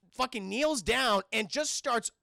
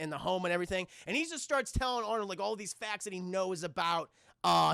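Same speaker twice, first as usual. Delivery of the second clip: ordinary talk, nothing marked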